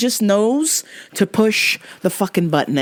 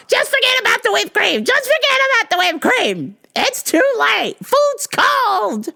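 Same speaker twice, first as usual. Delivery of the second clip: much higher, nagging mom voice; exasperated mom. Retold or imitated. Mom on the phone telling son to forget the cream, high and exasperated. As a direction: squeal it out like a fed-up mother.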